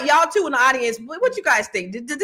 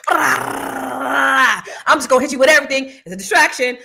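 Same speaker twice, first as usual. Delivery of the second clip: louder, higher mock Trump, gloating; imitating Trump hitting everyone at once; 4 semitones higher, 6 dB louder than usual. Host mocking the president's blitz, louder mock Trump voice. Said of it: "he just".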